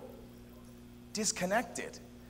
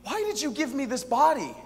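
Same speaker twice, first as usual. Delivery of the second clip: higher, louder cry to God; pleading prayer; 6 semitones higher, 5 dB louder than usual. Retold or imitated. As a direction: plead upward in protest. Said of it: "my own voice saying".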